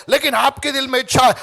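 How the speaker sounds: ordinary talk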